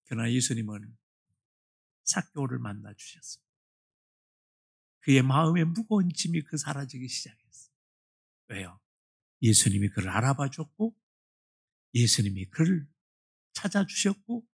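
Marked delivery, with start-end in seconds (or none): none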